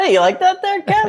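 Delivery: high-pitched laughing question. Real, not acted. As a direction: ask it through laughter. Laughing after the singing bit.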